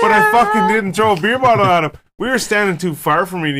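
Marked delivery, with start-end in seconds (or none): 0.0-1.9 indignant denial. Real, not acted. Denying blame, indignant.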